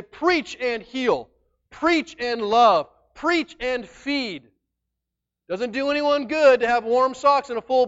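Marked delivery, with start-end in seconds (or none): 0.0-4.5 "Preach" hit hard each time, very slow; slow commands. Acted. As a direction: spell out each command like a charge. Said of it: "sends them out to say".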